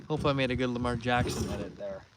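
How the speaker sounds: ordinary delivery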